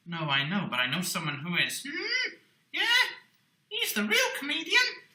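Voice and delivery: ordinary talk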